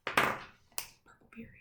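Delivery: soft stage whisper; whispered classroom cue. Acted. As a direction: whisper it like a secret. Teacher whispers a punctuation cue.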